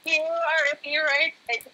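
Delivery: high, delighted talk through laughter; laughing hard. Real, not acted. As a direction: declare it while cracking up. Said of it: "I'm laughing so hard".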